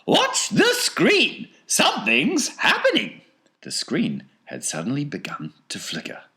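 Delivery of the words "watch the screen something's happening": very high, loud falsetto; piping alert to everyone watching; excited Wonka. A narrator's reading. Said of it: "said Mister Wonka".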